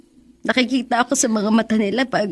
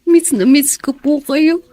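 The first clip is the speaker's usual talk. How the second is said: longing tearful; 6 semitones higher than usual. Acted. Son missing dead father.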